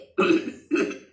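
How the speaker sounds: a loud fake throat clear. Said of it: "बाहर से आवाज आई".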